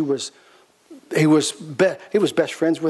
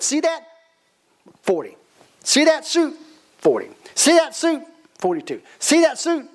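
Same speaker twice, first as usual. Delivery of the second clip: shopkeeper showing suits; 9 semitones higher than usual. Retold or imitated. Owner shows suits one by one.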